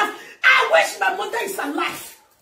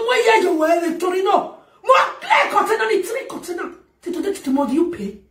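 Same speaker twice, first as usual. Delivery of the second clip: ordinary talk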